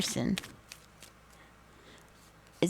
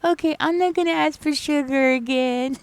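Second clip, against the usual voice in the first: put-on sweet old patient; 8 semitones higher than usual. Patient Irene apologizing to her crying nurse, high sweet coo. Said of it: "She was like".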